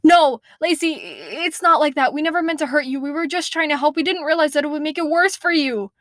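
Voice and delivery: high, urgent protest; pleading exclamation. Acted. The monsters insisting to Lacey they meant well, high pleading. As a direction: talk her down fast and high. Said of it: "they exclaim".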